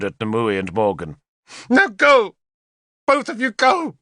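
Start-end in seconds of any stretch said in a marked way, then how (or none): 1.7-4.0 high, loud shouted order; furious dismissal. A narrator's reading. Queen screaming Nimue and Morgan out, high and loud. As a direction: throw them out with a scream. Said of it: "spat her hatred".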